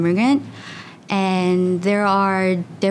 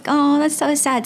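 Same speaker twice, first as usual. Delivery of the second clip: high, put-on pity voice; a lightly voiced, pitying "oh" to her friend; mock sympathy; 8 semitones higher than usual. Replying to a friend who regrets missing research, in mock pity. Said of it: "And I was like".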